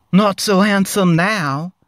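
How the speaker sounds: loud high gloat; Harry jeering at Ron; gloating thought. A narrator's reading. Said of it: "thought Harry".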